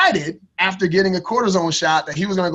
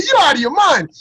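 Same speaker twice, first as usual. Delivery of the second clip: higher, louder you're-out-of-your-mind; a loud high cry of refusal; incredulous protest; 10 semitones higher, 5 dB louder than usual. Retold or imitated. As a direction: throw it back at her in disbelief. Refusing to compete on stage.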